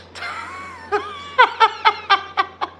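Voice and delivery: squealing burst of laughter; high laugh. Real, not acted. Laughing at a nobody in chat, high laugh.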